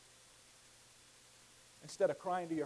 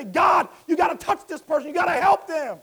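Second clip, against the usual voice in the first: loud, high shout for someone else; shouted intercession; 10 semitones higher, 13 dB louder than usual. Acted. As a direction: cry out for the one you're burdened for. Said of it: "with a burden that you say".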